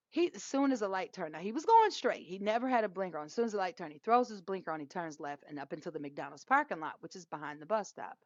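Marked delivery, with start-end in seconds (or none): none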